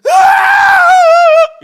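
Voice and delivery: long, very high, loud anguished wail; drawn-out sobbing scream; wailing cry. Retold or imitated. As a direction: let out a howl of repentant weeping. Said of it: "이런, 이런 거예요".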